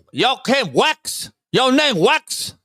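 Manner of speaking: high, slow, put-on mocking voice; mock foreign voice. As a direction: drag it out high like a caricature. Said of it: "How you spell your name?".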